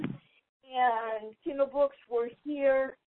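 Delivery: soft, very slow, talking while typing; distant, distracted typing voice; away from the phone. Real, not acted. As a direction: draw out each word as you type it. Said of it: "I'm setting down the phone".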